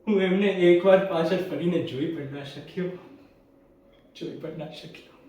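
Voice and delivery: regret dropping to a very soft echo; fading regret. Acted. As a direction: repeat it almost to yourself. Regrets not looking back at parents.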